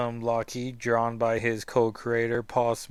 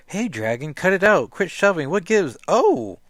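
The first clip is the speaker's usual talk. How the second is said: raised, exasperated shouting; loud protest; 7 semitones higher, 11 dB louder than usual. Acted. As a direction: shout at a shoving pet. Kitty voiced, shoved by dragon.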